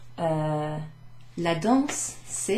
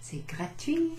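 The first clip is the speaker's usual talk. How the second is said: mock glee; 7 semitones higher, 3 dB quieter, slower than usual. Shown as a demonstration. Adjektiv freudig vorgespielt.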